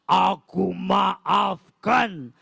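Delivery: slow, deep kindly lion, forgiving. Acted. Lion forgives the little mouse, slow and deep. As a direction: draw the words out like a big gentle lion.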